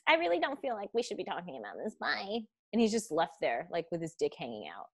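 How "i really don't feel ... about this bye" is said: quick, higher, airy brush-off voice; mocking dismissive woman. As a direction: mimic her brushing him off. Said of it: "she's just like".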